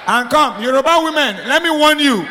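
high, loud comic telling-off; loud scolding warning. Acted. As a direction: warn them at full voice. Mock warning to Yoruba women.

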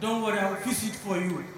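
soft, low soothing tone; calm reassurance. Retold or imitated. Rich man calming the angry driver, soft reassuring. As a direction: settle him down gently. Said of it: "The rich man say".

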